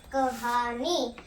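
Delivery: कहानी said very slowly; slow model word. Shown as a demonstration. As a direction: stretch कहानी out for the class. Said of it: "teacher models answer".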